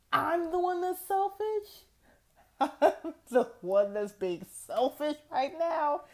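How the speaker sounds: high, loud, incredulous; indignant disbelief. Retold or imitated. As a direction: throw the word back at her in disbelief. Indignant retort.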